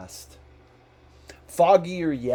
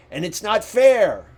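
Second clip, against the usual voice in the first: put-on higher, louder indignant snap of an old miser; indignant Scrooge; 8 semitones higher, 9 dB louder than usual. A narrator's reading. Scrooge to his clerk, loud and indignant. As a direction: complain hotly that it is unfair. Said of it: "said Scrooge".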